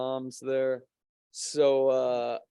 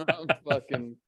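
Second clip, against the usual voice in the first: laughing. Real, not acted. Laughs at own joke.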